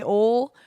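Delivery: "ol" as one short syllable, no oi glide; mock oil said ol. Shown as a demonstration. Host showing listeners a southern oil, said as ol. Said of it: "Like, if you say".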